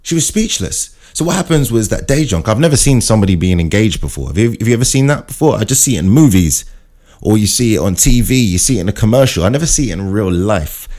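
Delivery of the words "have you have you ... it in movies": ordinary talk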